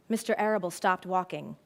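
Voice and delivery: ordinary talk, nothing marked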